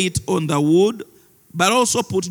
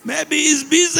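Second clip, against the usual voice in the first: mocking shout; 10 semitones higher than usual. Elijah mocking Baal's prophets, high taunting shout. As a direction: jeer across the mountain at them. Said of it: "Elijah began to mock them".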